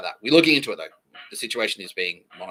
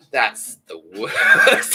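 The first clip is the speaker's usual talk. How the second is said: laughing; 12 dB louder, much slower than usual. Real, not acted. Laughing at his own bit.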